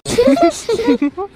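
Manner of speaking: high giggle at her friend's misery; laughing despite her tears; teasing laugh. Real, not acted.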